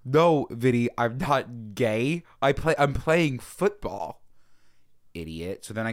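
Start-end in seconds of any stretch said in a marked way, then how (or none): 0.0-4.2 louder and very slow, hammed-up protest; mock indignant retort. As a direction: drag out the denial like he's an idiot. Acting out indignant reply.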